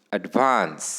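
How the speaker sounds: "Advance" with a long, falling second syllable; stress on second syllable. Shown as a demonstration. Teacher showing example word to students, "advance" stressed on Vance. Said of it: "the stress is on the".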